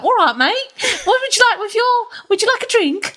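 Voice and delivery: sending up a Cockney flight attendant; mock Cockney. Critic mocking a Cockney flight attendant, laughing. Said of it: "speaks like".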